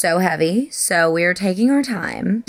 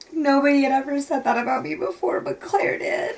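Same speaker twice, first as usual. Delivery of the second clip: high, tearful voice breaking at the end; crying; 7 semitones higher than usual. Real, not acted. Reader quoting the girl's line back, crying. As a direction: repeat it through tears, voice giving way.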